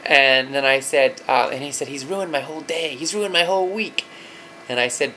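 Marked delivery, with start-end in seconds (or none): 1.9-4.0 put-on higher, whiny hurt kid; aggrieved child. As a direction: complain like a wronged little boy. Upset son quoted by father.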